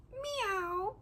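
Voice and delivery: soft, high-pitched little meow; tiny high kitty voice; cat meow. Pretend. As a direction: mew quietly up high like a cat. Imitating the cat's meow.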